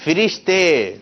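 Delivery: "فرشتے" drawn out as fi-rish-te; correct pronunciation. Shown as a demonstration. Host revealing the right answer, correct fi-rish-te pronunciation. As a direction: announce "فرشتے" with short i first, firmly falling. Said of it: "درست تلفظ ہے اس کا".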